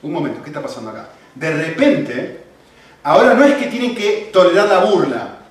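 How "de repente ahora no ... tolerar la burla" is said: full-force preaching. Acted. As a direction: preach it out loud to the whole church. Preaching to the congregation.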